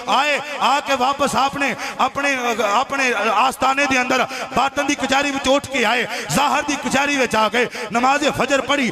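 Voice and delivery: high-pitched, driving sermon storytelling; heightened preaching. Acted. Preacher telling the congregation of the saint's vision, in heightened delivery.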